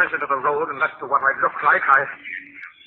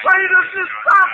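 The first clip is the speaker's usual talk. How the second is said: much higher and louder, cracking with panic; panicked scream; 9 dB louder than usual. Acted. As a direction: break into a terrified shriek. Tormented by the voice.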